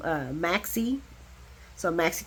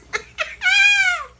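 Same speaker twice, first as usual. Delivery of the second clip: shrieking laugh; 6 dB louder than usual. Real, not acted. Laughing at paper hitting Lois.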